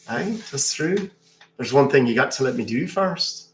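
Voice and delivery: lilting Irish brogue; leprechaun. Acted. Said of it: "I'm a leprechaun".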